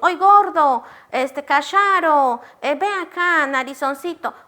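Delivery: put-on high, loud teasing call to a chubby child; mocking teacher. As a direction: call the kid names like a careless teacher. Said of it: "le decimos".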